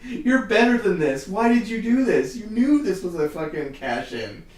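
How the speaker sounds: high, loud and slow, scolding; mock angry troll rant. Fan scolding Sam Rockwell in his angry email, hammed-up. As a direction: berate the actor like a hammed-up betrayed fan. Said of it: "I went on total angry troll rant".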